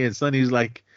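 ordinary talk, nothing marked

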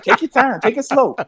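coaxing patiently. Retold or imitated. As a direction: urge her to take it easy. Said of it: "that's what".

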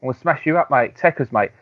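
brash British trash talk; cocky British lad. Retold or imitated. British wrestler threatening Dominion, cocky British lad accent. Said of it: "And, uh".

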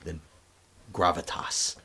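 low, soft, weighty single word; mock gravitas. Co-host mocking the actor's grand manner, low and weighty.

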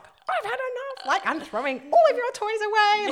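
hammed-up furious mum; rage cleaning. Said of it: "where you're just like".

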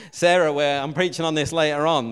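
loud, jokey protest; hammed-up indignant call-out; mock outburst. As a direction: pretend to tell off a colleague, loudly. Mock threat to preacher stealing his text.

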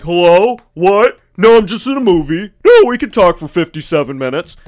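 mock loud cinema phone-talker; idiot on phone. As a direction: imitate someone obliviously taking a call. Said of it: "idiots that answers their phone".

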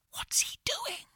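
breathy nervous whisper. Acted. Boy whispering to Jake in the dark tunnel, hushed whisper. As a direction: whisper it so he won't hear. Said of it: "I whispered to Jake".

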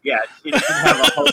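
high, loud, laughing through the line; laughing quote. Retold or imitated. Recapping the show to a friend, laughing through the quote. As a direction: crack up while quoting. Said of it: "And he's like".

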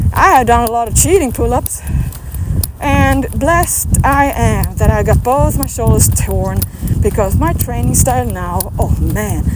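ordinary talk, nothing marked

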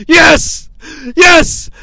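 high, loud chant of yes; yelling. Retold or imitated. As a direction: shout it like a cheering fan. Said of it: "He yells".

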